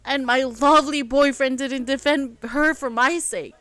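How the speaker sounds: loud, sarcastic lovely; bitter sarcasm. Acted. Elle mocking her boyfriend to James, sarcastic. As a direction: spit the praise back.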